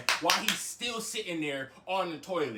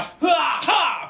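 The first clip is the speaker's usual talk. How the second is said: loud, high "ha" with each blow; forceful mimed punch exhalations; punching grunts; 8 semitones higher, 5 dB louder than usual. Retold or imitated. Imitates punching the bed.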